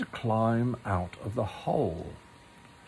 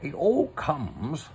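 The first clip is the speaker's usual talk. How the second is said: a touch of crossness, slow and a little higher; cross; 4 semitones higher, slower than usual. A narrator's reading. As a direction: grumble it out, mildly annoyed. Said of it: "said Pooh crossly".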